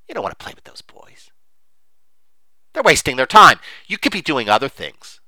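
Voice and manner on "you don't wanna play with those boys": whispered. Pretend. Repeated as whisper.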